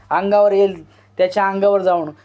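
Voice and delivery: plain reading voice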